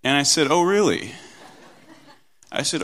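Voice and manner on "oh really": higher, louder challenging question; retold dad's incredulous comeback; mock disbelief. Dad answering his defiant three-year-old, mock disbelief.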